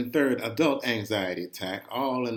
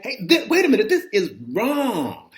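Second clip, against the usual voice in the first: higher and louder, panicked as the ride runs backwards; alarmed cry; 9 semitones higher, 7 dB louder than usual. Retold or imitated. Boy on the Ferris wheel, panicking at his dad. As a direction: blurt it out in sudden alarm, slowing on the last words. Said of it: "And I'm like".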